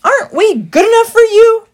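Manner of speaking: high, loud yell. A narrator's reading. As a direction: shout abuse at him. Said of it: "he yelled".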